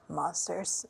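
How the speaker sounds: ordinary delivery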